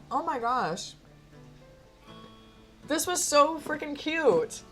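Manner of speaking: high, loud, drawn-out delight; gushing. Real, not acted. Player sums up the cute game, gushing. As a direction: gush slowly over something adorable.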